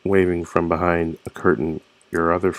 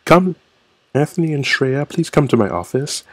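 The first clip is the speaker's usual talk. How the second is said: higher-pitched imitation of a summoning teacher; mock teacher; 6 semitones higher, 3 dB louder than usual. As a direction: call students in like a mock teacher. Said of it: "The teachers would be like".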